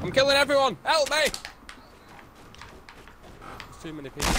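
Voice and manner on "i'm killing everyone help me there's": high panicked cry for help; panicked yell. Real, not acted.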